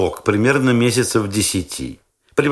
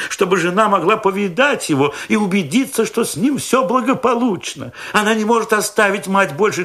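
high, eager proud-father voice; a doting young dad, pitched up; fussy young father; 11 semitones higher than usual. A narrator's reading. Young father boasts to narrator about his baby, high and eager.

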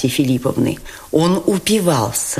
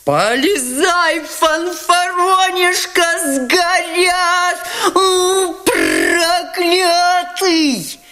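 much higher, slow, desperate yelling; frantic badgering; 13 semitones higher, much slower than usual. Ferdyshchenko urging Ganya to grab the money, frantic. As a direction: berate him in a frenzy.

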